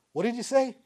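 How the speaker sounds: high-pitched, astonished question; acted startled question. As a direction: re-enact someone stopped short by an apology. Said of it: "And the Marine goes".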